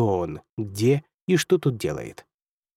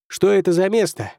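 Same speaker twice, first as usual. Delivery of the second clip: higher, louder call into the wind; shouting over wind; 7 semitones higher, 4 dB louder than usual. A narrator's reading. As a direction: shout against the gale. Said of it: "прокричал он сквозь ветер".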